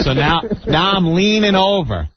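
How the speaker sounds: normal talking voice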